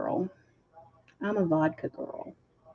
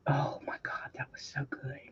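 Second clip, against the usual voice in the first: breathless whisper, dropping to almost nothing; 3 semitones lower, 12 dB quieter than usual. Real, not acted. Remembering the rich cake, whispered in awe. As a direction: hush it like a delicious secret.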